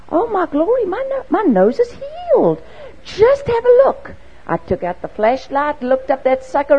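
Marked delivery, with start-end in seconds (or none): 0.1-4.1 much higher and louder, astonished; mother thrilled her nose is healed; amazed exclamation. Retold or imitated. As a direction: cry out in delighted wonder.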